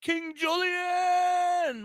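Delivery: drawn out, high excited call; hype shout. Real, not acted. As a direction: stretch the name out like a hype man. Hyping up his co-host.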